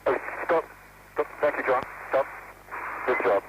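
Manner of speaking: crackly space-to-ground radio; radio-filtered spacewalk chatter; astronaut radio. Real, not acted.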